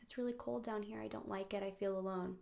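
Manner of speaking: soft, forlorn and small; hushed, mildly miserable complaint; lonely little bear. Acted. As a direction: speak quietly from the bottom of a hole. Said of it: "the bear looks up and says".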